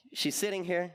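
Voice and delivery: ordinary talk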